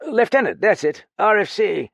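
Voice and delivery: high, loud tipsy eagerness; pleased boozy officer confirming; eager drunk. Acted.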